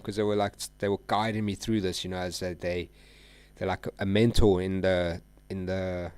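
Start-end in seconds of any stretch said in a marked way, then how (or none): none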